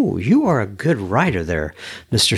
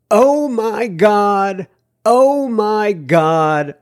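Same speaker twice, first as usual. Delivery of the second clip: drawn out, higher, stretched delight; mock excited reading; 6 semitones higher, much slower than usual. Host reading a fan email, slow mock excitement. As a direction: read it out like a gushing fan. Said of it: "in big capital letters".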